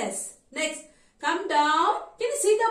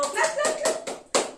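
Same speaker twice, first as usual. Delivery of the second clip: excited cheer; 12 semitones higher than usual. Real, not acted. Praising the class.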